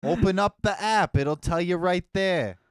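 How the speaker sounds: put-on loud, brash New York accent. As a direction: push it like a pushy New Yorker, New York accent. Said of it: "that's a pretty New York accent you got going".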